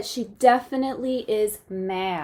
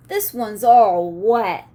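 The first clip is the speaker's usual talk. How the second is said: slow, louder, a hint of a dog voice; lightly voiced dog; 3 semitones lower, 7 dB louder, slower than usual. The dog remarking to the girl, slow and a bit louder. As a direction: play the dog, matter-of-fact.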